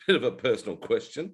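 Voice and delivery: chuckling through the words; amused, laughing echo; laughing repeat. Real, not acted. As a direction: savour your own joke, laughing as you say it. Repeats own quip while laughing.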